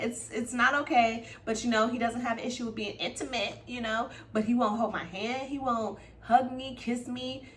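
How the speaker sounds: ordinary delivery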